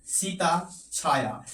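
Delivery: Sita Chhaya drawn out, syllable by syllable; slow example. Shown as a demonstration. Teacher slowly dictates Sita Chhaya to students taking notes. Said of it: "यही चीज तो बता रहे थे ना".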